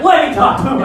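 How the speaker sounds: high, loud ominous request; mother about to scream at the fiancé; hammed-up threatening mom; stern demand. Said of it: "she's like".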